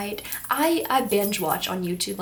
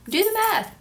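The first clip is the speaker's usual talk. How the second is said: higher, louder teasing repeat; playful emphatic; 11 semitones higher, 6 dB louder than usual. Real, not acted. Vlogger teasing viewers to work out her age. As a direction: nudge viewers, go on, count it.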